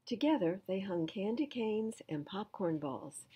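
plain reading voice

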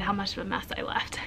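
whisper. Real, not acted. Confessing the messy classroom.

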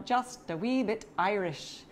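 slow, playful Irish lilt; amateur Irish brogue, drawn out; Irish accent. Pretend. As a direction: put on your best Irish accent. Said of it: "that was my best Irish accent".

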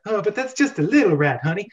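a hint of a mother calmly dismissing; lightly voiced mom shrugging off a rat; imitating mom. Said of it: "how my mom would react".

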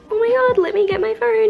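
high, loud excited gasp at the cute dog; excited squeal. Retold or imitated. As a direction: grab the phone, thrilled. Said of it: "I'm like".

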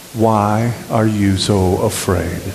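slow, deliberate question; measured recitation. Acted. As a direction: lead the congregation through it word by word. Recites key question with congregation.

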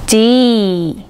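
"ジー" as a d plus long ee, "dee"; drawn-out "ジー" with a hard d; English letter D. Shown as a demonstration. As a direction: tap the tongue, then hold "ジー" as "dee". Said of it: "という子音と".

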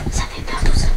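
hushed whisper in hiding; whispering while hiding. Real, not acted. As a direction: whisper so they won't hear.

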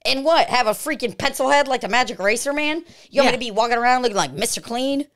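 indignant. Acted. Indignant about shaving advice.

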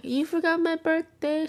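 high, plaintive retold question; wounded accusation. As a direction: whine it in disbelief, pitched well up. Retells accusing mom on phone.

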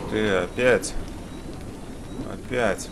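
ordinary delivery

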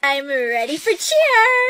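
loud, drawn-out cheer shout; excited cheerleader. Acted. Cheerleader announces herself to the squad, excited. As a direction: belt it out slowly like a cheer.

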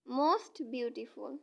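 very slow, deliberate most beautiful; slow model phrase. Shown as a demonstration. Teacher modelling the superlative for students, very slowly. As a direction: drag out most beautiful for the class. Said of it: "তখন সেখানে হবে".

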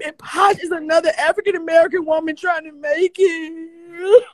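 tearful and high, breaking; crying. Real, not acted. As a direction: keep sobbing through the complaint. Crying about rumors.

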